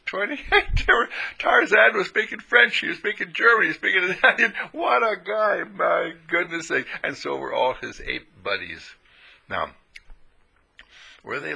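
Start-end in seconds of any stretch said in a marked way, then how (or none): 0.1-6.9 excited marvelling. Real, not acted. Marvelling at the translated movie.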